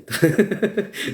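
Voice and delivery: high, breaking-out chuckle; real giggling burst; amused laugh. Real, not acted. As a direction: laugh at your own story. Laughs at his own story.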